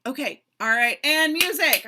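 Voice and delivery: loud, high rehearsal call; calling a cue. Acted. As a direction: call out to restart the music. Choreographer cues the music.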